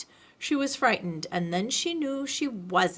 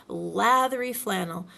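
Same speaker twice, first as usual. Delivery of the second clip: ordinary delivery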